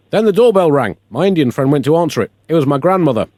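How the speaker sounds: ordinary talk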